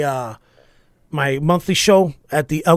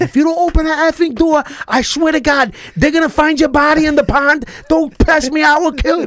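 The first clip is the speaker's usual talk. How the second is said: high, loud mock-furious threat; losing it, cussing; 11 semitones higher, 5 dB louder than usual. Dad threatens daughter through closed door, acted rage. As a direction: blow up like a dad at the end of his rope. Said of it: "I ended up cussing her".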